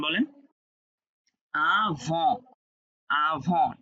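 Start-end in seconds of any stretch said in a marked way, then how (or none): 1.8-3.7 "আভন" ending on the nasal vowel, no t; silent final T. Shown as a demonstration. Teacher models the rule, "আভন" with silent final t. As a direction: leave the t off the end of "আভন". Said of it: "টি silent রাখলাম".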